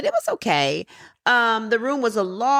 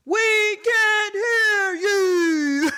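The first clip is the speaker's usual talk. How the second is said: slow, drawn-out, much higher call across the room; mock holler of a listener who can't hear; hammed-up heckle from the back row; retold shout from the back; 11 semitones higher, much slower than usual. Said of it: "somebody in the back goes".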